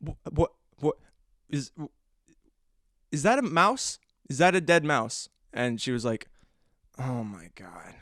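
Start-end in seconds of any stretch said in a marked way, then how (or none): 0.1-5.3 stammering, halting, then rising disbelief; retold freak-out. Son asks his mother about the dead mouse, stammering in alarm. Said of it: "I was freaking out". 7.0-7.9 low, soft, understated reaction; retold muted dismay. Mother looks at the dead mouse, quietly dismayed.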